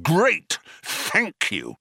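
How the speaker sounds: exasperated sarcastic thanks; snapped. A narrator's reading. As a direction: snap your thanks impatiently. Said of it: "snapped Zaphod".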